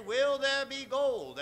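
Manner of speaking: ordinary talk, nothing marked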